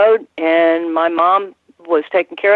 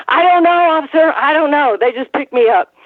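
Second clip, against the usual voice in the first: hammed-up English accent going innocent; 9 semitones higher than usual. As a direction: do Davy Boy's thick English accent, pitched up. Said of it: "Davy started in his English accent".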